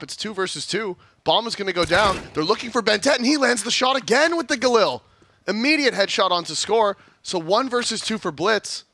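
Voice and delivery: excited live play-by-play; esports caster. Real, not acted. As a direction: call the action as it happens.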